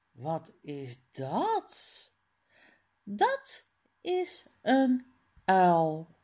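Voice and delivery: usual speaking voice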